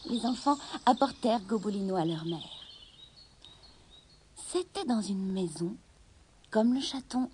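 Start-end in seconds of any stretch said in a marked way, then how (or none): none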